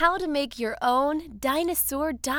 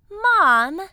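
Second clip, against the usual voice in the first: calling out; 5 semitones higher, 10 dB louder than usual. Acted. Jeremy calls out to Mom.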